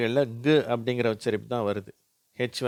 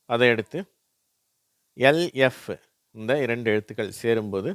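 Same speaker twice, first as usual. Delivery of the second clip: usual speaking voice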